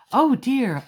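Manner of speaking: dismayed exclaim over the mess; high, loud, rueful oh dear. Acted. Octopus inked sea lions.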